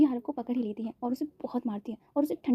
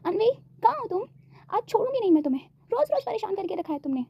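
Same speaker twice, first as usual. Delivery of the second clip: higher, sharper scolding voice; angry scolding; 8 semitones higher than usual. A narrator's reading. Mother hunting for Anvi, angry and raised. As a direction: scold the hiding daughter, pitch raised. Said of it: "Nita जी गुस्से से".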